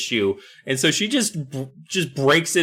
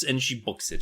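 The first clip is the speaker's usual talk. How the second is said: usual speaking voice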